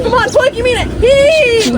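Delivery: rally the crew with force; urging shout. Real, not acted. Leading crew hauling a rope.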